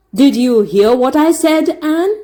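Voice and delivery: stern schoolmaster. A narrator's reading. Teacher demanding Anne obey him, stern. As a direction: press her for an answer. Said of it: "queried Mister Phillips sternly".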